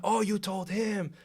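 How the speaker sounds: high, slow, drawn-out realisation; retold accusation. Player realises coach told, retold drawn-out accusation. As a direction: stretch it out accusingly, re-enacting the row.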